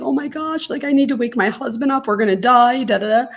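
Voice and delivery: hammed-up frightened wife; mock panic. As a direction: play up your own tornado panic. Mock panic quoting herself.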